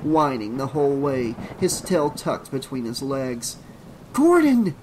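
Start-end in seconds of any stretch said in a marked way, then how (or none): 4.1-4.7 high, loud, strained call; strained shout. A narrator's reading. Trish calls after her fleeing dog, high strained shout. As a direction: call out urgently after the runaway. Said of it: "shouted in a strained whisper".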